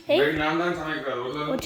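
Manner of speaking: put-on deep, slow, drawn-out baby talk to the puppy; silly puppy voice. Owner cooing at her new puppy, slow deep silly voice. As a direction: stretch it out low like a goofy cartoon voice.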